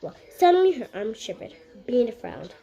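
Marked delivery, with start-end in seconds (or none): none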